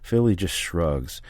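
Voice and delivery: plain reading voice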